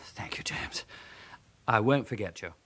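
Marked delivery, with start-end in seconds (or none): 0.0-0.8 breathy whispered thank-you. Acted. Grateful farewell while hiding.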